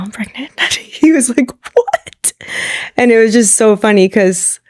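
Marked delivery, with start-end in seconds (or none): 0.0-0.6 much softer, almost swallowed; sheepish confession. Retold or imitated. Owning up to her husband in the car, much softer and sheepish. 1.7-2.3 much higher, stunned squeak of disbelief; shocked what. Retold or imitated. Husband hearing the pregnancy news, much higher and shocked.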